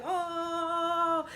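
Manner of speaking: long, high held "oh" of a class egging on a fight; classroom crowd reaction. Retold or imitated. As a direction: imitate a whole class oohing. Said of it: "everybody in the class like".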